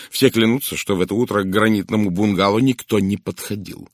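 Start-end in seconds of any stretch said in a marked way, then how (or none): none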